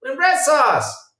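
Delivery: hammed-up baffled viewer shouting; mock outraged shout. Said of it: "I'm like".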